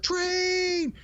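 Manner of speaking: high, drawn-out imitation of a kid's alarm call; mock yell, pitch shooting up; Gordy's mock warning yell. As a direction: call out a warning like a panicked boy. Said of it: "Gordy yells out".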